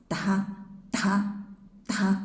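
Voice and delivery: "Da" voiced with a push of breath; breathy da. Shown as a demonstration. Teacher showing breathy voiced Da. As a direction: breathe through the da. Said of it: "next one with breath".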